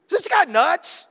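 a high, amazed question about Paul; incredulous. Real, not acted. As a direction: ask it like you can't believe it. Incredulous aside.

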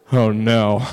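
loud, dramatic doom; hammed-up horror, heavy and booming; mock dread. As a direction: announce disaster with big mock gravity. Dramatic dread at mom's chore list.

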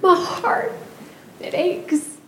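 real crying through a farewell; tearful. Real, not acted. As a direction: fight back tears while speaking. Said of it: "I wasn't gonna cry".

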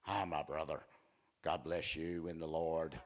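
low, hushed, pious greeting; overly holy soft-voiced church greeter; fruity churchgoer. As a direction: murmur a mock-holy blessing, low and soft. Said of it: "Stop being so fruity".

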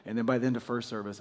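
usual speaking voice

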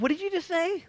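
high disbelieving what did you just say; a jaw-dropped listener repeating back; incredulous. Retold or imitated. Preacher reacting to the skeptics, high incredulous retort. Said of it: "I look, I'm like".

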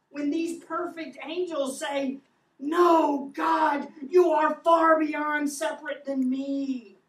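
loud, high, fervent sermon; thundering pulpit delivery; impassioned preaching. Acted. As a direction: preach it with full fire. Preaching at full force.

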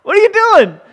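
very high, loud startled what; hammed-up shocked squeak; shocked disbelief. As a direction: squeal like someone just caught a thief. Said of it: "today we would be like".

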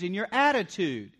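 normal talking voice

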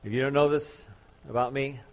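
ordinary delivery

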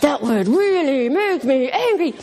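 slow, swooping cartoon hero; mock-heroic struggle against anger; Larry Boy. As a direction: stretch it out in hammed-up restraint. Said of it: "And he's like".

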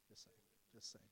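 teasing whisper, under the breath; whispered aside. Real, not acted. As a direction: slip it in as a whispered joke.

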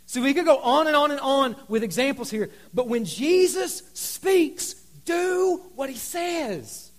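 high, drawn-out closing charge; emphatic preaching. Real, not acted. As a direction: stretch the key words for the congregation.

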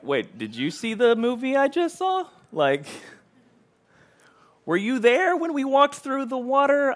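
high-pitched mock disbelief; incredulous. Moses answers the grumbling people, incredulous. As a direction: pitch it up, incredulous at their short memory.

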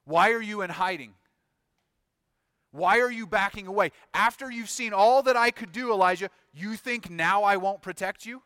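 loud, high, incredulous rebuke; booming, affronted questioning; indignant challenge. Acted. God challenging Elijah, incredulous.

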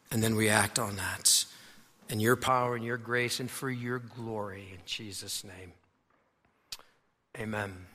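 low, hushed prayer voice. Real, not acted. Opening prayer, low and soft.

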